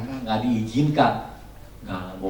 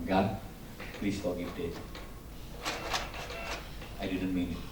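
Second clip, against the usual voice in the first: soft apology; 4 semitones lower, 15 dB quieter than usual. Retold or imitated. Retelling his apology to the angry daughter.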